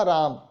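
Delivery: राम with the final a dropped, mouth closing on m; demo pronunciation. Shown as a demonstration. Teacher demonstrates राम to students. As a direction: say राम as spoken, ending shut on m. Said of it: "इसको मैं आपको बोल के बताता हूँ".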